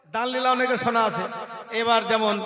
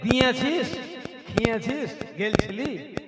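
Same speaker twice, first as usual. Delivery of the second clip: village Rarhi dialect; 2 semitones higher, 4 dB quieter than usual. Retold or imitated. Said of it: "ক্যানি বলি না".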